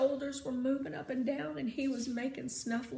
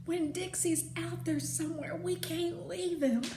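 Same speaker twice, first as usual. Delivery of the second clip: higher, pleading and insistent; urgent plea; 6 semitones higher than usual. Acted. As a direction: beg him not to quit. Opal begs to keep searching.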